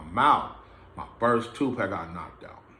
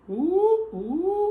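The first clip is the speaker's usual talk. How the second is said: high cartoon-style ooh of pain; 4 dB louder than usual. Storyteller mimicking his swollen nose, high cartoon ooh. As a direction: squeal it like a cartoon character getting hit.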